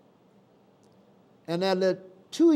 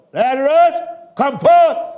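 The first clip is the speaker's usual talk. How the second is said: loud, higher command for him to come out; loud call; 9 semitones higher, 12 dB louder than usual. Shown as a demonstration. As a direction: call Lazarus out of the grave with full voice. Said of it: "I just get loud".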